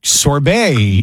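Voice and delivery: "sorbet" slowly as sor-BAY; careful pronunciation. Shown as a demonstration. Host tries the unfamiliar word for co-host, sorbet said slowly as sor-BAY. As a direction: sound out "sorbet" with care, ending in a long -bay. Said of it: "you were so careful about it".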